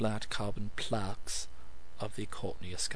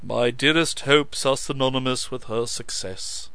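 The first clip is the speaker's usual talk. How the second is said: intoned; 2 semitones higher, 7 dB louder than usual. A narrator's reading. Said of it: "he intoned".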